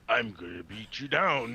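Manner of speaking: slow, menacing cartoon villain. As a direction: growl it like a cartoon bad guy. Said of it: "he's fucking cartoon villain voice guy".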